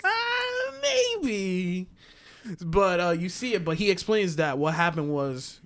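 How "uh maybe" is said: high, very slow and drawn out, half crying; goofy Boba Fett. Retold or imitated. Friend mocks Boba Fett dodging the question, high, slow whiny voice. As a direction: whine it out in a silly high voice, stretched long. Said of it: "And he's like".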